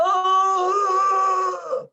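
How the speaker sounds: high, loud, drawn-out wail; imitated howl. Imitating his father's cry to a listener, high loud howl. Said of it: "y gritaba".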